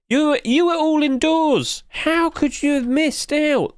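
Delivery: slow, high-pitched wail; mock disbelief. Streamer to viewer who missed out, slow high mock disbelief. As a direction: draw it out high in hammed-up disbelief.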